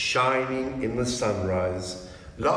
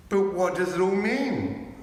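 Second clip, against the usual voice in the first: high, pressing question; eager wonder; 8 semitones higher, 3 dB louder than usual. A narrator's reading. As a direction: ask in amazement. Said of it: "asked Susan".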